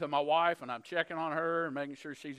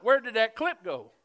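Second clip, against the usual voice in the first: higher, louder panicked question; frantic staff; 6 semitones higher, 6 dB louder than usual. Retold or imitated. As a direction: play the nurses scrambling for the lost clip. Staff panicking, running round room.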